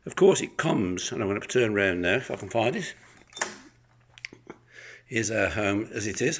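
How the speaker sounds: normal talking voice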